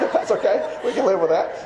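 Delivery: amused, still laughing. Real, not acted. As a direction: talk through the laugh. Laughing through his own joke.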